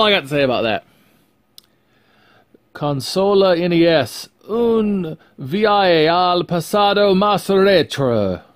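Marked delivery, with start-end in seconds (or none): 2.7-8.4 slow, higher hammed-up Spanish read. Reading the Spanish box text aloud, hammed-up Spanish. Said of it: "I prefer en français".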